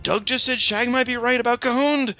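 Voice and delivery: very high, hammed-up squeal of triumph; mock excited announcement. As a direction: crow it to everybody in a silly high voice. Mock announcing his vindication to everyone.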